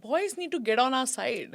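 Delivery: ordinary talk, nothing marked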